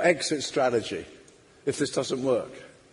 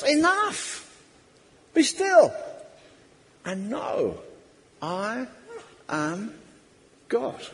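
gentle divine voice; 6 semitones higher, much slower than usual. Acted. God speaks tenderly to us.

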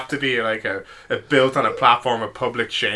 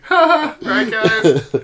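mock-crying, voice pitched way up; tearful forced laugh; 13 semitones higher, 4 dB louder, much slower than usual. Embarrassed pillow-screamer addressing onlookers, mock tearful. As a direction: force a high, weepy laugh for the crowd. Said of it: "crying a bit".

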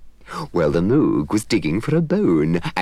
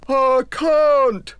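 lightly voiced helpless Moog; 4 dB louder than usual.

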